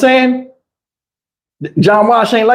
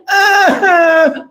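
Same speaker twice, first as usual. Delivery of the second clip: mock sob; 9 semitones higher than usual. Mock crying again.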